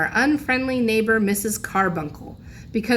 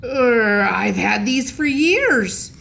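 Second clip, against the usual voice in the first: shocked officer; 3 semitones higher, 4 dB louder, slower than usual. A narrator's reading. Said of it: "Officer Raitt looked shocked".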